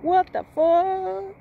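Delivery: high, loud, slow astonished cry; mock shock at the new washer; stunned disbelief. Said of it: "I was like".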